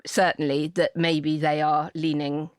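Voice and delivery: usual speaking voice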